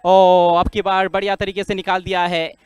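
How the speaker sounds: ordinary talk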